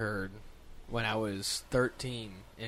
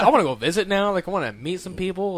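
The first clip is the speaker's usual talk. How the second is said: louder, higher eager rush; 6 semitones higher, 9 dB louder than usual. Retold or imitated. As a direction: say it like you can't wait to go. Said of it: "I was like".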